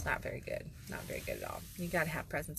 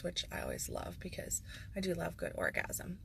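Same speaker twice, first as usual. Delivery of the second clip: whispered aside; 7 dB quieter than usual. Real, not acted. Speaker confiding to viewers, whispered. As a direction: softly confide it with a breathy hush.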